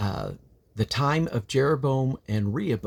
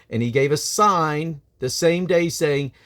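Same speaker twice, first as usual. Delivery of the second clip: solemn, declaiming Bible reading, raised and louder; scripture reading; 6 semitones higher, 4 dB louder than usual. Acted. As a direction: proclaim the chapter from the pulpit.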